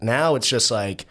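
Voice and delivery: ordinary talk, nothing marked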